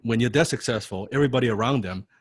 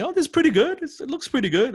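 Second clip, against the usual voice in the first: much higher, mock-impressed praise; mocking coworkers; 4 dB louder than usual. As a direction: imitate gushing yes-men. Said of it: "the other people in the company".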